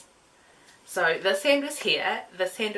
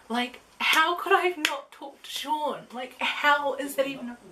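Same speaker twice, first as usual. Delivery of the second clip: incredulous; 6 semitones higher, 4 dB louder than usual. Real, not acted. Exasperated at herself.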